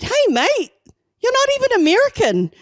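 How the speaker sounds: high-pitched, loud retold challenge to a mate; mock incredulous call-out. Speaker to friend putting on an American accent, mock incredulous. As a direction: call out your friend in high, exaggerated disbelief.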